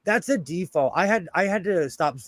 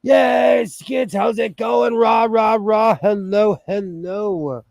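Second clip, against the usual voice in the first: excited greeting; 3 semitones higher, slower than usual. Real, not acted. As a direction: whoop a hello, stretching the words. Greeting an arriving viewer with cheers.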